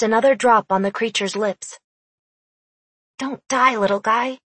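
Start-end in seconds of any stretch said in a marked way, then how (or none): none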